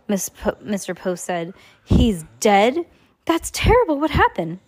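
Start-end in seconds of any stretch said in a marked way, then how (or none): 1.8-4.6 high, loud, startled questioning; shocked reaction. Acted. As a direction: react aghast to terrible news. Reacting to news of death.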